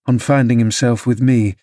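ordinary delivery

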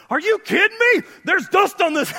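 hammed-up parent horrified at dust; exaggerated shocked nitpicking parent; outraged picky parent. Picky parent voiced complaining.

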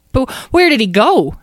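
high, loud little question; worried mouse. A narrator's reading. Mouse asks after lost Charles, worried. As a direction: plead in a mouse voice. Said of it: "asked the mouse".

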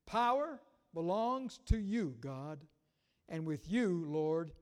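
loud, declaiming the psalm; preaching voice, full conviction; scripture read with force. Acted. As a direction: proclaim it to the congregation. Slow, building psalm reading.

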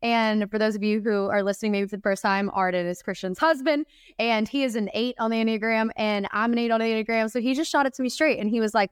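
normal talking voice

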